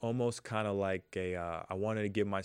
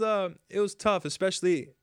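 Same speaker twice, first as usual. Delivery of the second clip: ordinary delivery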